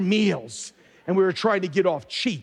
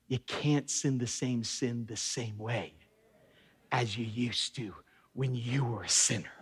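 hushed emphasis; 8 semitones lower, 9 dB quieter than usual. Real, not acted. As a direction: drop the voice low to drive it home. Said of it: "stay with me".

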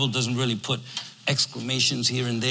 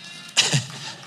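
breathy laugh. Real, not acted. Laughing at his own joke.

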